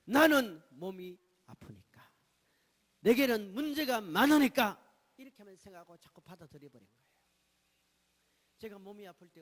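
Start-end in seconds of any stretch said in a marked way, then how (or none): 0.0-4.9 much louder, slow, drawn-out lament; preacher voicing his old thoughts as a slow loud lament; self-pitying thought. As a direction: wail out the old self-pity. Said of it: "이걸 어떻게만 생각했냐면".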